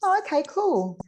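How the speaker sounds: high, breezy, unbothered daughter; lightly voiced easygoing daughter; casual daughter. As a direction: shrug it off as no big deal. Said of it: "she was like".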